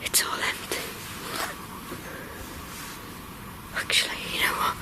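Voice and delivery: soft hushed whisper; real whisper. Real, not acted. Ghost hunter whispering as he creeps back in. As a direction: whisper so nothing hears.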